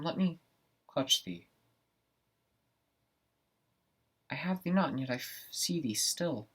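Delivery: stage soliloquy to a vision, pitch leaping up; startled Macbeth. Acted. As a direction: recoil and reach out, in broken bursts. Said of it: "performing a segment of Macbeth".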